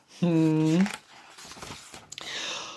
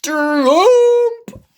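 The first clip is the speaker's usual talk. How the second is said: high loud blaring toot; loud trumpet blast; 7 dB louder than usual. Acted. Elephant blasting trumpet at Piggy, loud high blare.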